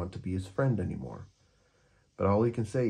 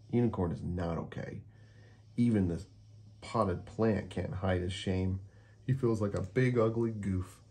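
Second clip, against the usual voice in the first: plain reading voice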